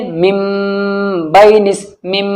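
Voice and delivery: "मिम" with a long hummed m before "बैनिस"; ghunna and ikhfa. Shown as a demonstration. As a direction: linger on the m of "मिम". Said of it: "हो रहा ना गुन्ना इख्फा के साथ". ("मिम" में गुन्ना खींचकर)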